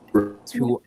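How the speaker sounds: usual speaking voice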